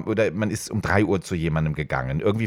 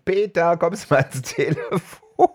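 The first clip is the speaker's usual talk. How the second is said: laughing call; 9 semitones higher than usual. Retold or imitated. Calling father to the phone, laughing.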